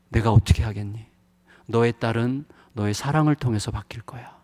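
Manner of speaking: ordinary talk, nothing marked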